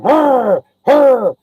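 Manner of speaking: high, loud doggy growls; muzzle-full dog snarls; imitated dog growl. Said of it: "like that".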